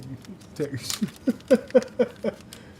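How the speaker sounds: high burst of laughter. Real, not acted. Laughing at own mix-up.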